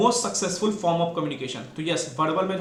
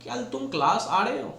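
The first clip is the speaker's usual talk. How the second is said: higher pitch, rising at the end on "रहे हो"; question tune; 5 semitones higher than usual. Shown as a demonstration. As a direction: ask "क्या तुम class आ रहे हो" with a clear upward lift. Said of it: "तो pitch पे depend करता है". (ऊँची पिच में प्रश्न)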